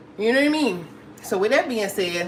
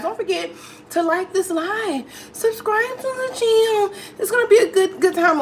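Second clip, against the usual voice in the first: high-pitched, gleeful appeal; excited plug; 10 semitones higher than usual. Real, not acted. Host urging viewers to like and subscribe, excited high voice. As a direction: pitch climbing high as you rally the viewers.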